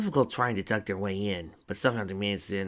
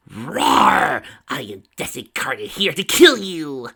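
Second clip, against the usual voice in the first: louder, drawn-out monster threat; amplified roar; 5 semitones higher, 12 dB louder, much slower than usual. A narrator's reading. Tracy booms through Lyra's spell, mock monster menace. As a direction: boom it like a magic loudspeaker. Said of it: "This will amplify your voice".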